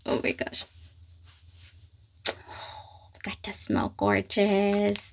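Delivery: breathless whispered wonder; souffle-le, émerveillée; awed whisper. Real, not acted. First sniff of perfume.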